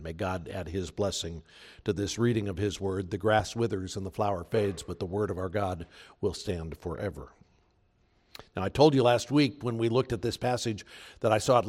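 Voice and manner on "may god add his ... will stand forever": soft, reverent benediction; hushed liturgical blessing; blessing over the reading. Acted. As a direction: bless the word gently. Closing prayer over the reading.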